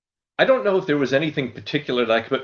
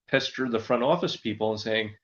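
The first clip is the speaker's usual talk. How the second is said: ordinary talk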